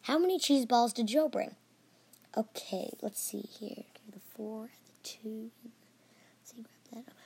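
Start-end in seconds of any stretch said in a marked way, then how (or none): 2.4-7.1 very soft mumble fading to whisper; muttering to oneself. Acted. Student working out a math word problem, muttering. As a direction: mutter under your breath while calculating.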